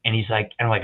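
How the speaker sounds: ordinary delivery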